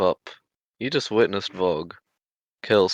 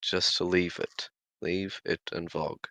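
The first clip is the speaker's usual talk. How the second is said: subdued appeal; 2 semitones lower, 7 dB quieter than usual. Acted. Character ends quarrel, asks Leonard.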